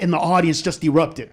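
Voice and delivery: usual speaking voice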